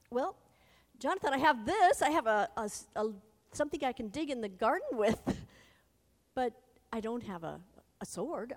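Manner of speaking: high, loud, flustered stammer of excuses. Pretend. Flustered armor bearer stammers his excuses to Jonathan, loud and high. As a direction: blurt out nervous excuses, voice jumping up.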